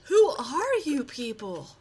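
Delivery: hammed-up stunned question; mock astonishment. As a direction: stretch it out in fake shock.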